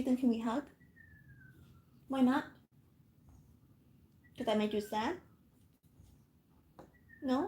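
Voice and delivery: quiet, lilting mother coaxing her sulking son; coaxing softly. Real, not acted. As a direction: gently coax the little one into a hug. Coaxing toddler softly, live.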